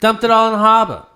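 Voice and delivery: put-on Boston accent. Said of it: "How bad are our Boston accents?".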